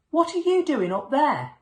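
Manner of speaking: calling out. A narrator's reading. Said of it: "called the fire chief".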